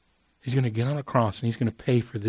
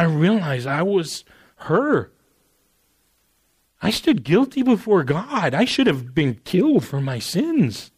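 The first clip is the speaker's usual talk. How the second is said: raised, higher sermon voice building; full-force preaching; impassioned preaching; 4 semitones higher, 4 dB louder than usual. Real, not acted. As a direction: preach it with rising fervour.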